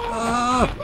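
high sharp shriek of fright; scared shriek. Real, not acted. Fleeing player shrieks in fear. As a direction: let out a startled cry.

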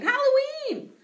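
high, loud, drawn-out shout. A narrator's reading. Boy answering the teacher's riddle with a gleeful shout. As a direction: yell it out in glee. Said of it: "everyone started shouting".

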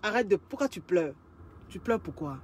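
low, soft hushing voice; calm her crying gently; soft soothing. Acted. Man soothing crying woman in story. (voix basse et douce pour consoler)

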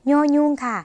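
"ยอยุง" pronounced nyo-nyung, slightly nasal; nasal Lao consonant name. Shown as a demonstration. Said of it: "เป็นเสียงขึ้นจมูกนิดหน่อยนะคะ".

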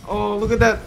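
ordinary delivery

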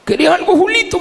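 preacher mimicking a pusher's call; imitated offer. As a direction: pitch it way up, calling out an offer. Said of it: "me decían".

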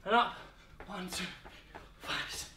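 breathless counting between reps; panting out the count mid-exercise; out of breath. Real, not acted. Counting reps mid-workout, gasping.

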